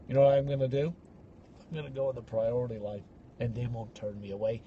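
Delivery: a hint of a cocky insider.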